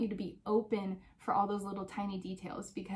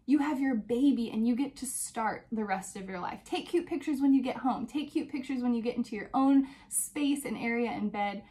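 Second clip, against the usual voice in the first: ordinary talk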